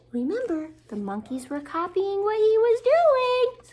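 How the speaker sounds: usual speaking voice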